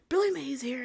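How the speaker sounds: loud, high TV pitchman; Billy Mays impression. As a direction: bellow it like an infomercial host. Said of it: "what's that guy's name? B-, Billy Mays".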